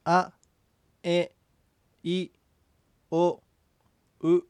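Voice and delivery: separate, clearly spaced vowels あ、え、い、お、う; model vowel pronunciation. Shown as a demonstration. Teacher modelling the vowels for the student, separate clear vowels あ、え、い、お、う. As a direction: say あ、え、い、お、う one by one as a model. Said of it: "発音してみましょう".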